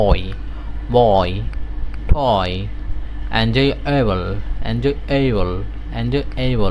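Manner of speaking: বয় and টয় with lips rounded on অয়; slow, careful demo of the oy glide; rounded oy sound. Shown as a demonstration. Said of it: "round হবে ঠোঁটটা".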